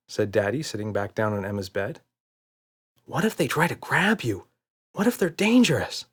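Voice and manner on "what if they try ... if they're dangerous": put-on higher, anxious child's voice; worried little girl. A narrator's reading. Emma worries the monsters will grab Daddy, anxious child. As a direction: ask fretfully like a kid.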